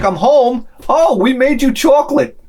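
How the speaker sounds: high, cheery family voices; happy Norwegian homecoming. Acted. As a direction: mimic a beaming girl and her doting family. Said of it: "she's like".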